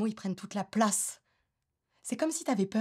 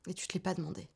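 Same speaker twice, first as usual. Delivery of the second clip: soft, subdued accusation; quiet reproach; 9 dB quieter, faster than usual. Acted. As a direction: say the reproach softly, evenly. Quiet first take of reproach.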